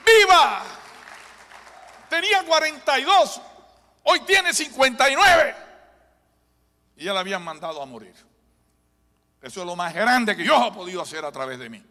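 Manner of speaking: very high, loud fervent preaching; preaching at full force. Real, not acted. Pastor testifying to the congregation, fervent full-force preaching. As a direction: lift the crowd with it.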